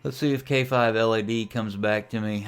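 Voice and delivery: usual speaking voice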